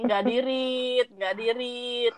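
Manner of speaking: drawn out very slowly. Retold or imitated. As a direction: stretch each word impatiently. Mimics her own impatient thought.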